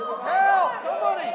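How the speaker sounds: loud panicked call for help; fluttering cries for help. Real, not acted. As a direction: cry out for rescue. Trapped man screaming for help.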